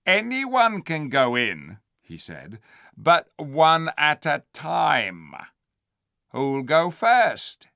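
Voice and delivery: slow, drawn-out menace; slow sly ape. Acted. The ape daring the beasts, slow sly voice. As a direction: dangle the offer.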